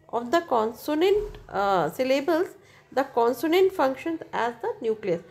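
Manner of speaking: slow, careful reading from the book; textbook reading. Acted. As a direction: read the definition out slowly for students. Reads textbook English slowly.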